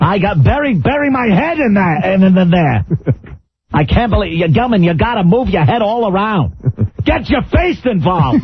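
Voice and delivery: high, eager Regis voice; Regis impression. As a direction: egg it on like a giddy TV host. Mocking Regis on air.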